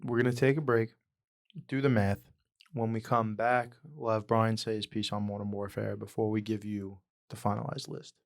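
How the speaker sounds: ordinary talk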